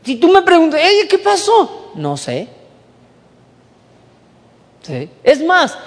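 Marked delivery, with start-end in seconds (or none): 0.8-1.9 puzzled questioning. Acted. Listener's imagined puzzled question.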